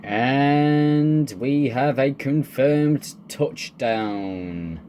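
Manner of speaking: slow, triumphant proclamation; announcer call. Pretend. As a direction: stretch it out like a big live call. Announces the tornado touching down.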